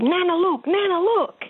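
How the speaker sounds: put-on high, piping little-girl voice, insistent; toddler's voice. Grandmother retelling granddaughter's call, high childlike voice. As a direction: play the excited toddler who wants Nana to look.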